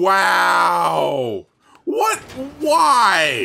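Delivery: loud, high outburst; incredulous shout. Real, not acted. Player blaming partner for the noise, loud incredulous shout. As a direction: yell in disbelief. Reacting to computer blaring.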